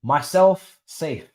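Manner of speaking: ordinary talk, nothing marked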